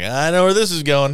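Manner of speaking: loud, high knowing exclamation; excited inner thought. Retold or imitated. Viewer voicing their thrill at a movie twist, loud. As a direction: blurt out the realization, loud.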